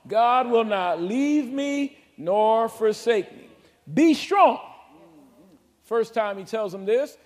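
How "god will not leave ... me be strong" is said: slow build to a loud "Be strong"; rising into a shouted command; bold declaration. Shown as a demonstration. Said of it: "Come on".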